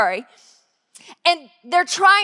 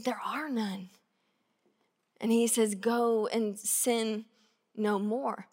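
soft, slow and tender; quiet gentle telling; hushed; 4 semitones lower, 10 dB quieter than usual. Retold or imitated. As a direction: say it gently like a verdict of mercy. Hushed retelling of scene.

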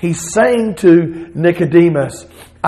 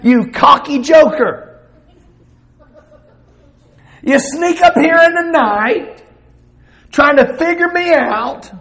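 Jesus rebuking; 7 semitones higher than usual. Acted. Jesus voiced rebuking Nicodemus.

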